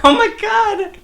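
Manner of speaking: high, loud astonished cry; shocked. Real, not acted. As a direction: blurt out in disbelief. Reacting to turkey gobble demo.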